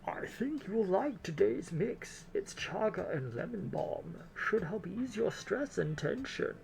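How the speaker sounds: drawn-out elderly lady, put on thick; grandma voice. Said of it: "my grandma voice".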